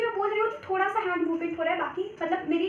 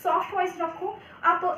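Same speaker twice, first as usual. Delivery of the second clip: ordinary talk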